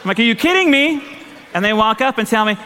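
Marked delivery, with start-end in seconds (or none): 0.2-1.0 high, stunned disbelief at the giant horse; a mock-shocked squeak. Rider stunned by the biggest horse, high disbelief.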